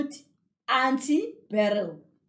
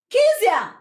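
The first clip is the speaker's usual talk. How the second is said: high, loud angry call; angry shout; 10 semitones higher, 9 dB louder than usual. A narrator's reading. Auntie shouts again for Kezia, angry shout. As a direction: shout the name sharply, cross. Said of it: "kusho ukuthi u-angry".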